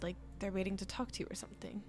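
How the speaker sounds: soft, fast whisper; quick whisper; whispered aside. Acted. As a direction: hush it quickly.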